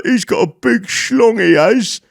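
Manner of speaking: high, loud laddish jeering; mocking loud London lads.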